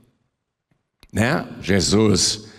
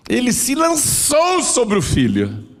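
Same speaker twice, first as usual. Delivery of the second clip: fervent exclamation; 13 semitones higher than usual. Acted. Excited preaching of the father's embrace.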